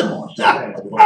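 loud, half-laughing rebuke; loud teasing reprimand; loud mock scolding. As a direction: call the plan out loudly, half amused. Mocking his own wig plan.

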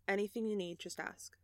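hushed, trailing almost to nothing; soft offer. Acted. Lav comforting Julian after his flashback, soft voice. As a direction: offer support very softly.

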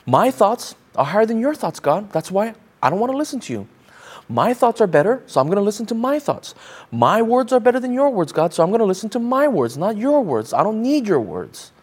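acting out a stubborn refusal to listen; mock defiance toward God. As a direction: talk back to God, self-important and emphatic. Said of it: "we're saying this to God".

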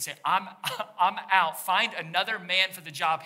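hammed-up reluctant Joseph; voicing Joseph. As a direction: play Joseph shrugging off the job. Pastor voices Joseph quitting.

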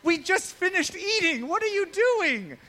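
high, loud mock outburst; hammed-up shout, words stretched out; exasperated dad. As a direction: yell in comic parental disbelief. Acts out exasperated dad at snack request.

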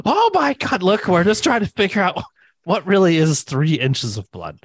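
high-pitched, hammed-up pleading; mock panicked excuse. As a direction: explain yourself as if caught red-handed, voice shooting up. Acted excuse when Sarah walks in.

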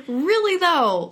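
high laughing burst. Real, not acted. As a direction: squeal it through a laugh.

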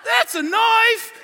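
slow, high shout as the Australian bushman; hammed-up Aussie hero's boast; Nick Dundee. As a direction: belt out the film catchphrase, long and loud. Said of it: "And he's like".

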